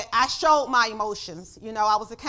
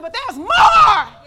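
defiant shout; 8 semitones higher, 13 dB louder, much slower than usual. Acted. Defiant answer to the enemy.

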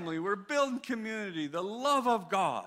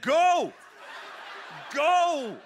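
booming command; 5 semitones higher, 5 dB louder than usual. Retold or imitated. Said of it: "He said".

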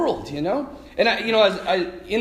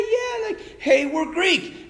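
eager crowd; 10 semitones higher, much slower than usual. Retold or imitated.

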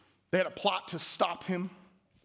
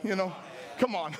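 normal talking voice

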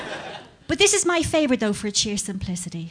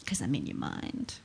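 much lower, quiet and sinister; creepy low murmur; 9 semitones lower, 10 dB quieter than usual. Acted. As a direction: menace them softly.

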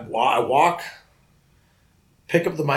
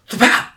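thwap sound effect; 11 semitones higher, 9 dB louder than usual. Retold or imitated. Retold body slam by guard.

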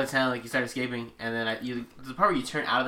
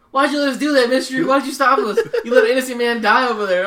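much higher, louder wailing complaint; hammed-up wailing leader, higher and louder; mock distraught leader; 11 semitones higher, 10 dB louder than usual. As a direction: wail at the stranger who stopped them. Distraught cult leader blames player.

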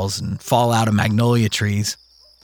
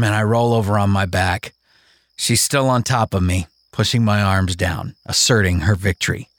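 plain reading voice

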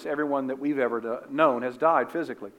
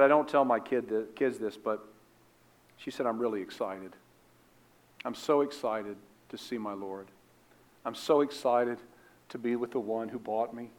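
mischievous confiding; 3 semitones lower, 6 dB quieter than usual. Retold or imitated. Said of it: "this sort of mischievous grin".

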